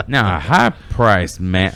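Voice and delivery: slow, drawn-out Texas drawl, half amused; Southern Texas accent. Acted. Reading the boss's lecture to a worker, in a Southern accent. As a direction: stretch it out in a big Southern twang. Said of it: "super, like, Southern slash Texas".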